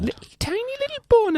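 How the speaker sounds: very high squeaky mock voice. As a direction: squeak it like a cartoon. Squeaky joke voices after jingle.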